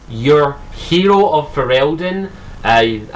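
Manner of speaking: ordinary talk, nothing marked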